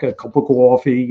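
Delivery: imitated heavy New York accent. Author mimicking a taxi driver's heavy New York accent. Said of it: "New York accent".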